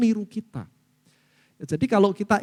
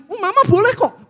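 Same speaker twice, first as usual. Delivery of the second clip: anak merengek riang; 13 semitones higher, 8 dB louder than usual. Retold or imitated. Anak menirukan izin mama.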